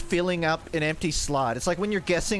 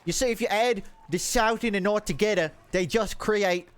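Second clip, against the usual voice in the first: higher, muddled North-South accent sliding British; accent mix turning British; 3 semitones higher than usual. Shown as a demonstration. Streamer explaining north plus south, accent slipping into British. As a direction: blend two American accents and land in British. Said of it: "it keeps going to British".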